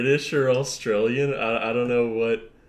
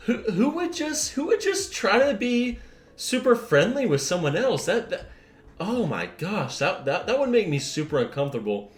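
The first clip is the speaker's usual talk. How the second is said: sarcastic disbelief; 7 semitones higher than usual. Acted. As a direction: ham up fake shock. Sarcastic outrage at friendly act.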